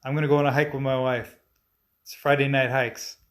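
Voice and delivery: normal talking voice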